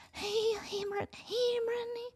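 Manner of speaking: high, soft, nervous calling; terrified fan's tiny voice at the door; timid knock call. Retold or imitated. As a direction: call out shyly, barely daring. Scared knocking on [name]'s door.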